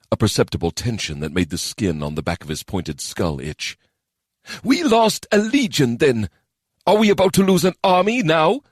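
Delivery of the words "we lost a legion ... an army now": frustrated anger. Acted. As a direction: press the warning angrily. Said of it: "frustrated and angry".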